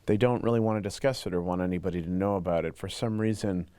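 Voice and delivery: deep pitch-shifted anonymous voice; disguised voice. Real, not acted. Anonymous interviewee, voice masked.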